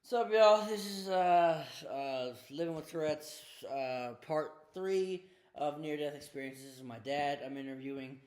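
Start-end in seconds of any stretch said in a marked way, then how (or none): 0.0-5.8 big, high-energy shouted greeting; loud show intro. Real, not acted. Host greeting viewers of his series, loud and hyped. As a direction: launch the show loudly, voice pitched up.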